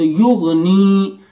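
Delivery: युगनी slowly, ग़ and न run together; jazm blending. Shown as a demonstration. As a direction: demo युगनी again joined. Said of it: "मिलाकर पढ़ेंगे".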